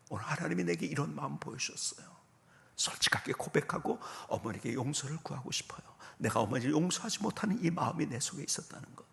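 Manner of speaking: ordinary talk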